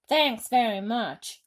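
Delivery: slow, loud sarcastic thanks; snapped. A narrator's reading. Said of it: "snapped the head of the fire department".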